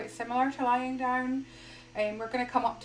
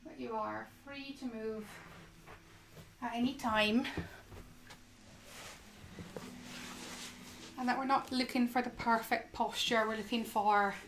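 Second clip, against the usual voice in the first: soft, unhurried yoga guidance with long pauses; guided meditation; 10 dB quieter than usual. Acted. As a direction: gently guide them into stillness, phrase by phrase.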